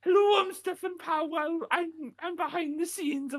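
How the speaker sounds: very high put-on Welsh accent; hammed-up, squeaky fake Welsh voice; Stephen Powell. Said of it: "a little bit more like Stephen Powell".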